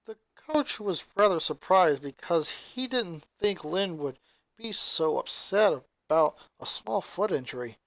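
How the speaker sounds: usual speaking voice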